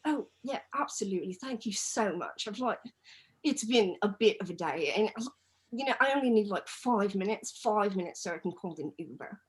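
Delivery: imitating an English guy's polite British accent; light, chirpy British voice of a stranded bloke; a fair British accent, lightly done. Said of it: "That was a spot-on British accent".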